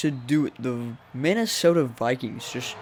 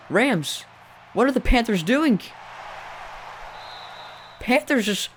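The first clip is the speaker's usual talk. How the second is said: voice cracking; 9 semitones higher than usual. Real, not acted. Commentator baffled by the Panthers' play call, voice cracking high. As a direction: crack up into squeaky disbelief. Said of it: "my voice is cracking".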